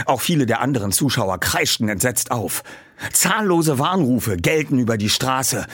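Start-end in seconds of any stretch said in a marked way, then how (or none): none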